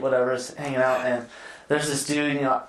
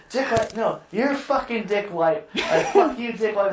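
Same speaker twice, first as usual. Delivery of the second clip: retold angry yelling; 6 semitones higher than usual. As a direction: throw the insult back, pitched up, half laughing. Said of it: "and start yelling at him".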